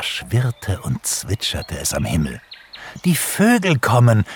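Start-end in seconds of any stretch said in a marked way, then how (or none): 3.0-4.2 high, excited announcement; joyful call. A narrator's reading. Pixie spots the returning birds, calling out joyfully. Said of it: "rief Pixie ... vor Freude".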